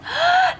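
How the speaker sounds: high loud gasp of surprise; sharp gasp. Acted. Miss Billy startled at her friends, loud gasp. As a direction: gasp in shock at the door. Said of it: "She gasped".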